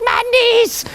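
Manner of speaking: high squeaky yelp about cold knees; a silly shrieking swimmer; mock yelp.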